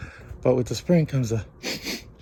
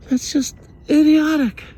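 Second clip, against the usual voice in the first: loud, high scornful outburst; exasperated outburst; 14 semitones higher, 9 dB louder than usual. Real, not acted. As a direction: blast it at the crooked pole. Ranting at crooked pole.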